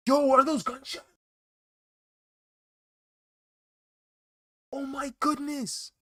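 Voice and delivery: high-pitched, startled outburst; alarmed shock. Real, not acted. Streamer hearing gunshots outside mid-chat, shocked high yelp. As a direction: blurt it out high and loud in real alarm.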